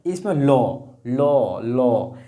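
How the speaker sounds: लो drawn out on its own, with its vowel held clearly; sounding out a syllable. Shown as a demonstration. Teacher sounds out लो for her pupils. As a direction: repeat लो slowly so the class hears the vowel. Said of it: "लो में अ का उच्चारण आ रहा है".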